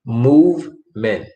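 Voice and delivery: "movement" slowly as move, pause, ment; syllable by syllable. Shown as a demonstration. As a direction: split "movement" into two separate, careful syllables. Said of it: "That word is pronounced".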